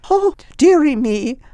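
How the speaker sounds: high, loud startled cry; a sudden shocked old lady; startled old woman. Acted. Old woman falls over.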